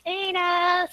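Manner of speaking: a high mock call from downstairs; mock yell. Acting out someone calling Aina from downstairs, mild mock yell. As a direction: call the name up the stairs, half-hearted. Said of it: "Someone's screaming from downstairs".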